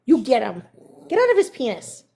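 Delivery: ordinary talk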